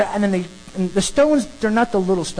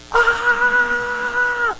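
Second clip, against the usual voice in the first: sudden high screaming cry, far off; mob scream. Retold or imitated. Preacher imitating the mob screaming at Stephen. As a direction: let out a high mob shriek.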